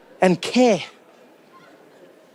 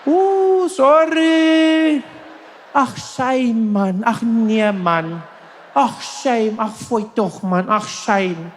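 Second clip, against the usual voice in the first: sympathetic South African bystanders; 4 semitones higher, much slower than usual. Pretend. Bystanders fussing over a fallen stranger, high cooing sympathy. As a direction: fuss over someone who fell. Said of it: "They'll all be there like".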